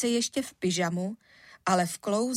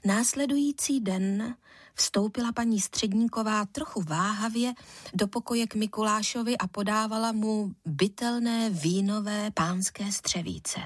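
ordinary delivery, nothing marked

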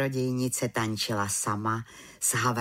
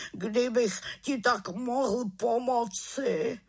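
remorseful wailing; moaning lament; 11 semitones higher than usual. Acted. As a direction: bemoan your own fault, drawing it out. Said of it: "sténal dobrý Abraxas".

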